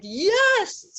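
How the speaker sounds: high, loud victorious shout; triumphant yell. Retold or imitated. Recalling the rush after the technique, a triumphant yell. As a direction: cheer like you just broke free. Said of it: "I was just like".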